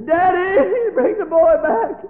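high, loud, crying plea; a mother begging her husband; tearful plea. Acted. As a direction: beg through sobs. Mother pleading in tears.

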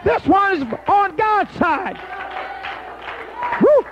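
high, loud, ringing preacher's cry, slowing at the end; roaring revival rebuke; full-force preaching. Acted. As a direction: rebuke the devil at the top of your voice.

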